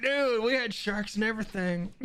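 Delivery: lightly mocking retelling; imitating him. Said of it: "He's like".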